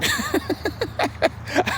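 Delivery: high, breathy wheezing laugh; helpless amused laughter. Real, not acted. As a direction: crack up in a long giggle. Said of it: "I'd be laughing pretty much".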